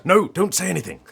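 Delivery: loud, high panicked plea; urgent shout. Real, not acted. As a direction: yell to stop her in time.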